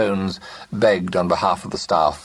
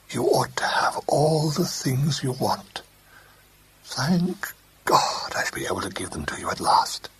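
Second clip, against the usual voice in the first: hushed, breathy promise; husky whisper; 7 semitones higher than usual. A narrator's reading. Husband promises Rosemary gifts, husky whisper. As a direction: whisper it close, almost no voice. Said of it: "he said huskily".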